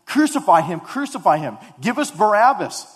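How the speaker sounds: mock mob shout, loud and rising; crowd yelling. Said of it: "Those guys that were yelling at".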